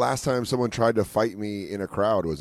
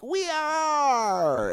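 high drawn-out mock whoop; long, high, squealed boast; mock cheer. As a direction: imitate a proud group whooping in unison. Group boasting at the bar, mimicked.

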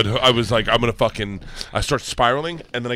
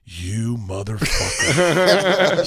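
laughing "motherfucker"; much slower than usual. Retold or imitated. Wife reacts, laughing.

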